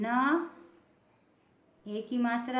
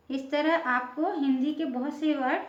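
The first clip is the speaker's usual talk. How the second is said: normal talking voice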